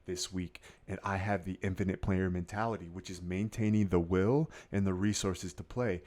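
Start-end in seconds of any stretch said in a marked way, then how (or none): none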